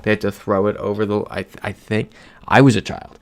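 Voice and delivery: normal talking voice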